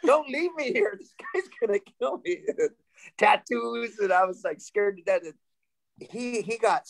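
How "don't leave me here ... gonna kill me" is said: higher, rushed, laughing plea not to be left; hammed-up fear, quick and high; mock panic. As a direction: beg your friends not to abandon you, half laughing. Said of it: "And I'm like".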